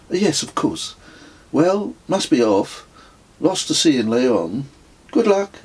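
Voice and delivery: high, bright cheery send-off; breezy brisk goodbye; cheery woman. Acted. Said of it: "she said cheerily".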